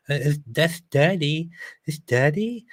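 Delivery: little son. Retold or imitated. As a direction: imitate a puzzled little boy. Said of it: "my little son was like".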